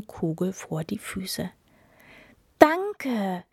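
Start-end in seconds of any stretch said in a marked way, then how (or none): 2.6-3.4 high, louder thanks; happy cry. A narrator's reading. Princess getting her golden ball back, happy cry. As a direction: call it out gleefully. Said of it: "rief die Prinzessin".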